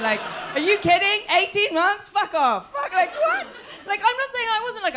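loud, high disbelief; incredulous shout. Real, not acted. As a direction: yell it in outrage. Outraged at eighteen months.

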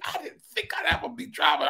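high, crying shout of joy. Real, not acted. Preacher testifying about his new car, crying shout. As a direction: let the tears break through.

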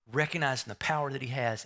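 ordinary talk, nothing marked

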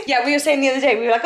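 normal talking voice

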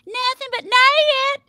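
"nay it" high and drawn out into two syllables; exaggerated Texas drawl. Imitating a movie character at quarters, "nay it" as two drawled syllables. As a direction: split the word into a twangy "nay it". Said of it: "nobody says nay it".

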